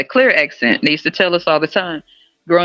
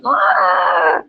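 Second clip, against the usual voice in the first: ordinary talk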